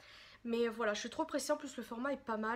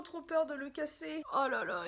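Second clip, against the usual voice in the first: normal talking voice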